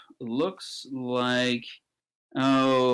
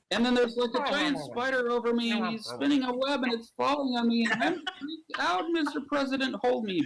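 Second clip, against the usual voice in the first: very high, hammed-up panic; freaked out; 11 semitones higher than usual. Man under a giant spider begs the President, freaked out. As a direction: plead in mounting mock terror.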